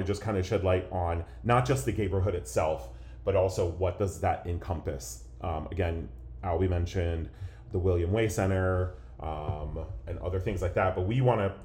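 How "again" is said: ordinary talk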